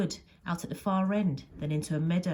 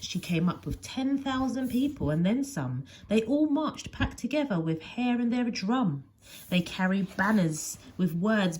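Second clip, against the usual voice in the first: ordinary delivery, nothing marked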